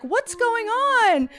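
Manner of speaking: put-on high, loud, drawn-out kid wail; slow mock whine, way up high; complaining children. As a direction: imitate kids calling out in confusion. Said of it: "there are children who are like".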